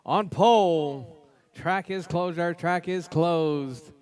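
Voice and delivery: loud, drawn-out lineup call with shouted names; starting grid announcer. Real, not acted. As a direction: boom each driver's name out over the track.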